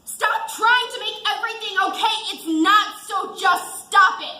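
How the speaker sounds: loud, high furious snap; shouting in frustration; angry outburst. Acted. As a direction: lash out to make him stop. Wife snaps at husband in anger.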